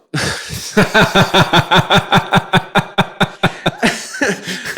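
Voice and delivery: high, long peal of giggles; real, sustained high laughter. Real, not acted. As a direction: crack up helplessly at your own story. Cracking up at own punchline.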